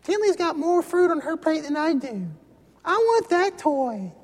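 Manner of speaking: put-on whiny child. Kid jealous over fruit and toy, whiny voice. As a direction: pout and whine about the plate. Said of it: "is what whining is".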